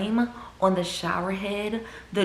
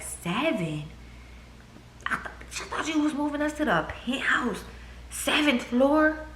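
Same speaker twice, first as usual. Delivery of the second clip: retold disbelief; 7 semitones higher, 5 dB quieter than usual. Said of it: "I'm just like".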